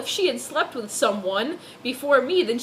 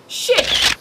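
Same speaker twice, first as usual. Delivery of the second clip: startled curse; 10 semitones higher, 7 dB louder than usual. Acted. Narrator realizes she forgot.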